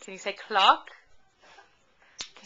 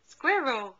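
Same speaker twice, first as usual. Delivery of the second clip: ordinary delivery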